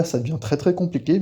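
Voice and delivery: ordinary talk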